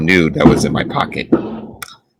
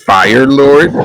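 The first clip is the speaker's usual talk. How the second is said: surprised; 8 semitones higher, 6 dB louder than usual. Real, not acted. Player surprised echo.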